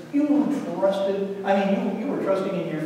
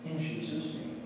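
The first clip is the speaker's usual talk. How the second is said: reverent prayer voice that sinks softer toward amen; closing prayer; 3 semitones lower, 4 dB quieter than usual. Acted. Pastor closing the children's sermon with a prayer, reverent and soft. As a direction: pray quietly over the children.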